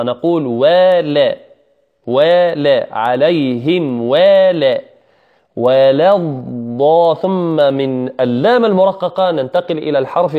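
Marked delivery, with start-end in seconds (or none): 0.5-7.1 ولا with a light و and ل, drawn out; teacher modelling ولا; slow demo of ولا. Shown as a demonstration. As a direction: stretch ولا slowly, light لام. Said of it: "الواو مرققة واللام مرققة".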